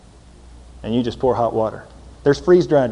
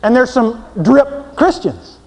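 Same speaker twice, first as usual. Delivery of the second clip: preaching; 11 semitones higher, 8 dB louder than usual. Acted. Preaching at full force.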